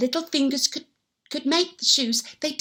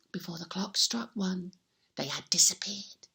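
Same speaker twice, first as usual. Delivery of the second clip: low whispered telling; hushed; 6 semitones lower, 10 dB quieter than usual. Acted. Telling children how the elves vanished, hushed whisper. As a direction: drop to a secret whisper.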